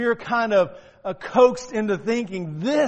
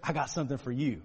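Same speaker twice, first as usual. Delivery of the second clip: lower, softer secretive offer; mock husband murmur; 7 semitones lower, 11 dB quieter, faster than usual. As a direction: tease the surprise quietly. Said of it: "he goes".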